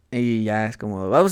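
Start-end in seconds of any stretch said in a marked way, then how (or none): 1.1-1.3 very high-pitched mock bewilderment; baffled "what?". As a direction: squeak out an exaggerated, comic "what?". Retold confusion at drawled English. (desconcierto fingido, muy agudo)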